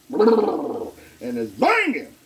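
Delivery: high and very slow, stretched out; drawn-out catchphrase. Real, not acted. Cook hyping his steaks, high and slow. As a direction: savor every syllable of banging.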